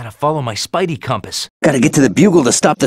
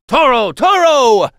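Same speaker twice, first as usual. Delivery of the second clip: loud, high, drawn-out call; taunting matador shout; bullfighter taunt; 9 semitones higher, 6 dB louder, slower than usual. Acted. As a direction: call the bull in, stretching each word. Taunting a charging foe like bullfighter.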